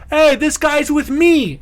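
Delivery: high, drawn-out shout from a height; yelling down. Acted. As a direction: holler it down from a chapel tower. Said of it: "he just yells down at the bulls".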